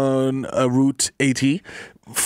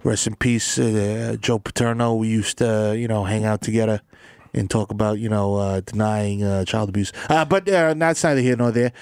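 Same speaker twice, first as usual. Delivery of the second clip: low, halting commentator voice; stuffy football pundit; 8 semitones lower than usual. Acted. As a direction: hem and haw like an old-guard pundit. Plays an old-guard football commentator.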